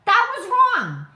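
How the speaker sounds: high, loud and firm; emphatic repeat. Real, not acted. Ranting to viewers, firm emphatic repeat. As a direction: hammer it home again. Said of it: "repeated emphasis".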